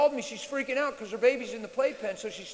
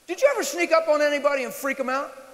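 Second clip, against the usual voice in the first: ordinary talk, nothing marked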